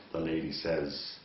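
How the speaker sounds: ordinary talk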